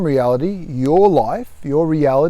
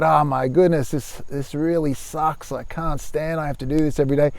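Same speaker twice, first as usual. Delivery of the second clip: softer inner-voice complaint; mock grumbling; 4 dB quieter than usual. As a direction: grumble it lightly under your breath. Voices a commuter's grumbling thought.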